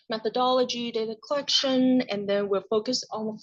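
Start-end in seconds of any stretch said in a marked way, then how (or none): none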